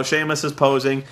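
ordinary talk

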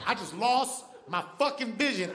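ordinary talk, nothing marked

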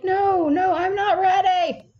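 hammed-up panicked wail; panicked protest. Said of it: "I'll be like".

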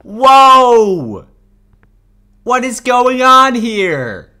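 high, loud, slow shocked cry. Real, not acted. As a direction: yell it out stunned. Sudden shock in the game.

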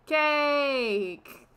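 high, loud drawn-out announcement; big excited reveal; excited shout. Acted. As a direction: call it out with gleeful gusto. Character suddenly offers cake.

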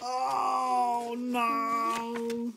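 high fed-up wail; exasperated dad. Acted. Sleepless dad at his wits' end, high wailing cry. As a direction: wail it like a worn-out father. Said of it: "And said".

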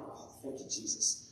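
breathy whisper of relief; relieved whispered prayer; whispered thanks. Retold or imitated. Relief after good diagnosis.

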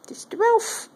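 very high squeaky name, rising; high pet coo. Real, not acted. Cooing to the cat.

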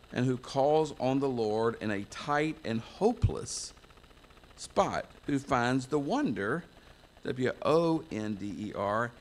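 ordinary delivery

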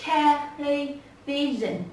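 "television" very slowly, syllable by syllable; từng âm tiết. Shown as a demonstration. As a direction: break "television" into separate slow syllables. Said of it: "Có bốn. Đầu tiên". (đọc "television" thật chậm, tách từng âm)

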